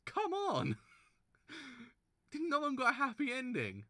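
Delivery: very high, loud protest; real exasperated shout; exasperated cry. Real, not acted. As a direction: plead at the story in outrage. Outraged no happy ending.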